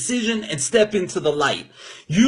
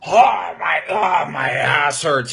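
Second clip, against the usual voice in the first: mock old complainer; slower than usual. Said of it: "I'm like".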